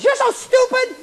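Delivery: high-pitched little voice, jeering; mocking voice in the head; nagging inner voice. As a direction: taunt in a squeaky little inner voice. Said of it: "a little voice says".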